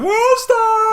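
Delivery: very high, loud onlooker holler; mock fight-video yell. Onlooker hollers at a street fight, mock yell. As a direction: yell it like a hyped bystander filming a brawl.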